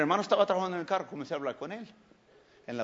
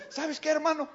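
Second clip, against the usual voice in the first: higher take; 9 semitones higher than usual. Retold or imitated. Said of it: "Así me dijo".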